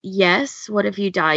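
ordinary talk, nothing marked

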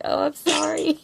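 high, put-on sheepish apology; mock apology. Acts her own apology.

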